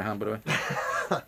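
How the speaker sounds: quick, laughing aside. Real, not acted. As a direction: chuckle through the line.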